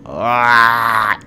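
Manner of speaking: loud, drawn-out "aah" of rage; frustrated yell. Real, not acted. Gamer so mad at the game, yelling in frustration. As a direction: let the anger out in one long yell. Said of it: "I'm so mad".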